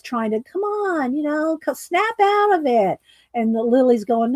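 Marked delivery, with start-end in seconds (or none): 0.4-3.0 lightly voiced owl, pitched up, coaxing; owl urging. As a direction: coax her like a fussy little owl. Mister Owl urging Lily.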